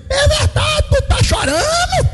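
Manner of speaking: high, drawn-out mock-surprised teasing voice; colleague's teasing question. Colleague teasing the judge about crying, high and drawn out.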